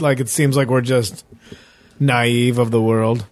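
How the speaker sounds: ordinary talk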